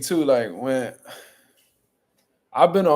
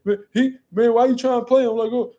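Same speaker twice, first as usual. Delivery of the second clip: mock whiny quote; 6 semitones higher than usual. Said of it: "H Money was like".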